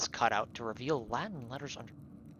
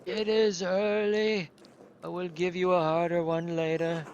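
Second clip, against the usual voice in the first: voiced monk; 4 semitones higher, 5 dB louder than usual. Voicing old monk character.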